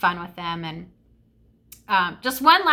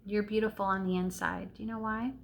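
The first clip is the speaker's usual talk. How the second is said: soft, affectionate murmur; tender reassurance; 8 dB quieter than usual. Real, not acted. Answering her sweetie's reaction, tenderly reassuring. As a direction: reassure a little one quietly.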